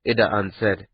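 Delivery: plain reading voice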